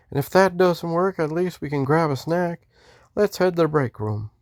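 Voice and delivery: high-voiced Texan greeting in a Western accent. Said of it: "I was doing a Western accent".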